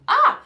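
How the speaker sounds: sudden high anguished wail; anguished cry. Retold or imitated. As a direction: let out a sharp cry of despair. Cries out in distress.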